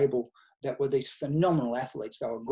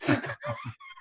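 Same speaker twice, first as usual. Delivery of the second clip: laughing; 5 dB quieter than usual. Real, not acted. Laughing at his own story.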